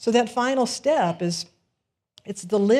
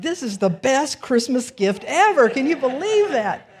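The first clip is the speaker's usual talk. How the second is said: loud, higher delighted exclaim; happy dance joy; 6 semitones higher, 8 dB louder than usual. Retold or imitated. Woman rejoicing after the officer left, loud delighted gush. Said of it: "started doing a happy dance".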